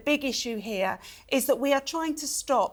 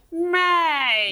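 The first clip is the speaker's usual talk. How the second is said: loud, raised, drawn-out disapproving "Mate"; horrified friend's mate; 5 semitones higher, 5 dB louder than usual. Acted. Mimicking horrified friends calling out a man, drawn-out reproachful mate. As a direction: call out a horrified, stretched "Mate". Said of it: "they're gonna go".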